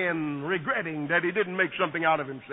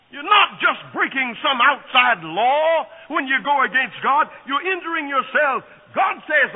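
louder, higher, slowing at the end; full-force preaching; 5 semitones higher, 4 dB louder than usual. Acted. Preacher warning the congregation, fervent.

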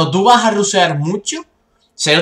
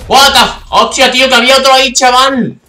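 high, loud startled outburst; startled shout; 8 semitones higher, 6 dB louder than usual. Real, not acted. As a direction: yell in shock at the enemy. Enemy suddenly in front.

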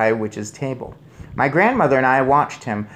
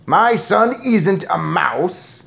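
slow, loud, higher denial; indignant shout; 7 semitones higher, 6 dB louder, much slower than usual. A narrator's reading. Father refuses to believe grandmother, shouting. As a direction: hammer out each word in outrage. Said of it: "shouted Mister Jenkins".

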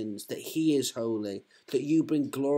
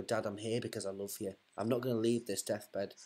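tender to dying dad; 6 semitones lower, 7 dB quieter than usual. Pretend. Son at father's deathbed.

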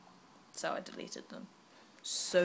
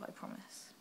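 normal talking voice

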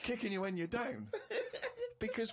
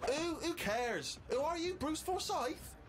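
put-on dismissive girlfriend; 12 semitones higher, much slower than usual. Girlfriend dismisses Steve as unknown.

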